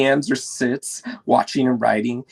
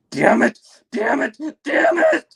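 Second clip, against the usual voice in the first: building louder and higher each time; furious shouting; 5 dB louder than usual. Acted. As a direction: scream it, rising to a shriek. Parents fighting offstage.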